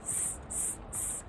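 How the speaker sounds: S, s, s as a voiceless hiss, no voice at all; hissed s sound. Shown as a demonstration. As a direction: hiss the s like a snake. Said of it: "S says s".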